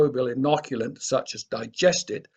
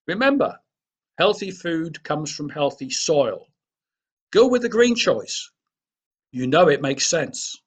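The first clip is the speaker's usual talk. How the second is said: upbeat slogan, pitched up; ad slogan read; 3 semitones higher than usual. Acted. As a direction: sell it like a radio ad tagline. Closing slogan of farm presentation.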